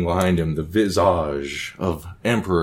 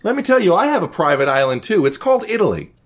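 much higher, giddy manic emperor chatter; Caligula, coked-out host; 9 semitones higher than usual. Acted. Caligula fawns over the guests on his boat, high and manic. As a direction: play a wired, delighted emperor.

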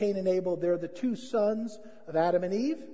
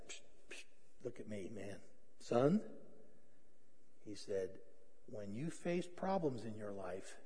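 very low and soft; hushed fatherly counsel; 10 semitones lower, 16 dB quieter than usual. Retold or imitated. Dad takes his son aside for a lesson, hushed. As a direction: draw the boy in quietly.

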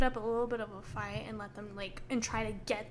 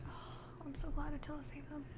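whispering; 4 semitones higher, 11 dB quieter than usual. Real, not acted. Hushed relief about Attila.

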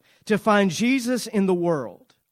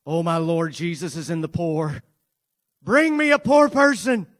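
mock zealous shout; 5 dB louder than usual. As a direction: shout it like an overeager churchgoer demanding a poor person.

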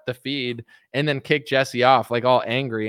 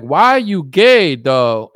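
imitating Jesse; 2 semitones higher, 8 dB louder, much slower than usual. Jesse pressing the pastor, slow loud put-on voice. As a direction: push the question hard, mock-bluntly. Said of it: "And Jesse will be like".

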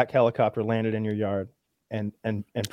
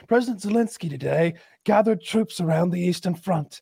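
higher, measured mock newscast; news reporter; 5 semitones higher than usual. Media covering the fake president, mock news voice. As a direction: report it like a TV correspondent. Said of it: "and they're like".

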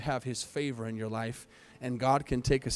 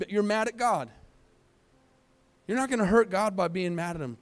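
higher, pressing sermon voice; preaching with urgency; rising preacher appeal; 6 semitones higher than usual. Acted. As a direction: plead with the doubting listener.

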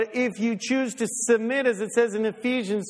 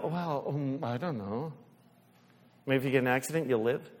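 much softer, lower, hesitant mock parent; softer, lower hesitant mock reply; 5 semitones lower, 5 dB quieter than usual. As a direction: answer grudgingly, trailing quietly.

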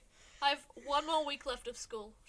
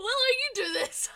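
giggling, much higher; laughing; 10 semitones higher, 7 dB louder, slower than usual. Real, not acted. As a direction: crack up while asking.

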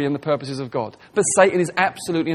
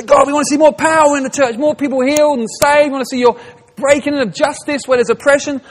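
higher and louder, eager pleading; fervent prayer; 9 semitones higher, 6 dB louder than usual. Retold or imitated. Church members praying for power and healing, loud and eager. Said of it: "So we say".

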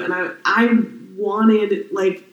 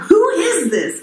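indignant who is this; 10 semitones higher, 3 dB louder, slower than usual. Retold or imitated. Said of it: "where I was just like".

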